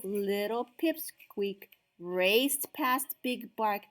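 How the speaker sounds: plain reading voice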